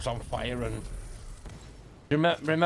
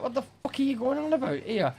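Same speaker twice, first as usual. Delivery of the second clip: much higher, outraged mock bewilderment; indignant disbelief; 9 semitones higher than usual. Pupil barred from class, indignant high disbelief. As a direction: play your younger self, baffled and offended. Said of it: "I was like".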